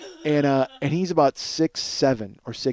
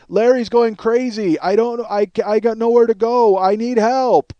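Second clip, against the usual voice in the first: imitated yelling; 7 semitones higher, 5 dB louder than usual. Neighbor begging to be let in, retold yelling. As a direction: act out a desperate neighbor yelling through a door. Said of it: "he starts yelling".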